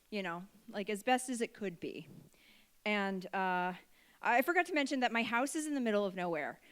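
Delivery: ordinary talk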